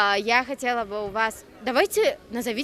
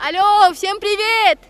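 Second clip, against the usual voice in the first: calling out; 6 semitones higher, 12 dB louder than usual. Real, not acted. Reporter calls out to boys.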